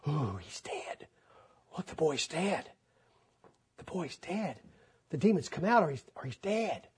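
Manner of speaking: a stunned crowd murmuring softly; hushed crowd murmur. Acted. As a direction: breathe it like a shocked onlooker. Crowd thinking boy is dead.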